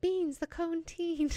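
high, slow put-on voice; teasing name-calling. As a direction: draw the nickname out high and playfully. Teasing Beans with the spooky nickname.

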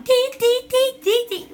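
loud, high staccato syllables; wordless yelling on one high pitch; high yelled "tee" chant. Shown as a demonstration. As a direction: yelp short rhythmic beeps. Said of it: "I'm just yelling".

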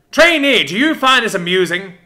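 a terrier rounding on a mouse, screaming. Acted. Sergeant screaming at a smirking trainee, loud and high. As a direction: scream it high and loud, right in his face. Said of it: "screaming the question".

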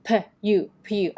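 "p you" sounded apart, then joined as "pyu"; P-to-you linking demo. Shown as a demonstration. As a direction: blend "p" into a short "you". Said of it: "เชื่อมกับ you ได้ยังไง". (แยกแล้วเชื่อม p you เป็น พยุ)

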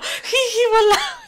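high giggling villain voice; mustache twirling villain. Retold or imitated. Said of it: "Eso está diciendo".

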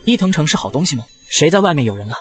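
usual speaking voice

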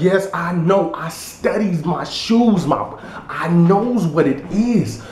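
high, slow, drawn-out show-off; mimicked funny guy. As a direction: imitate a jokey know-it-all, stretching the words. Mimicking the funny guy's reply.

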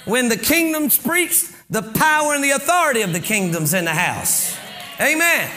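emphatic pulpit exhortation; fervent sermon voice; preaching. Acted. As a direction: drive each phrase home to the congregation. Exhorts the congregation.

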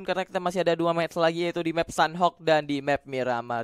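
ordinary talk, nothing marked

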